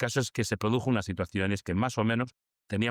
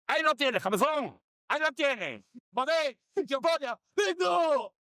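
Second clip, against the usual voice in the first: triumphant bingo shout; slower than usual. Retold or imitated. Winning bingo, retold triumph.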